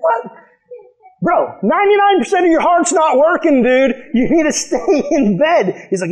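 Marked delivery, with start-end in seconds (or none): 0.0-0.3 sudden high squeak of disbelief; a yelp of pure disbelief; shocked what. Retold or imitated. Telling sick friend he can't leave, shocked high squeak. 1.2-5.9 exasperated plea. Retold or imitated. Begging friend to stay in bed, higher incredulous scolding. Pleading with heart-attack friend.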